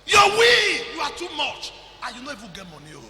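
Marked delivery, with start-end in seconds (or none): 0.1-1.7 loud scolding preacher, high and forceful; preaching shout. Real, not acted. Preacher scolding the congregation with a loud shout. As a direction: thunder it at the crowd.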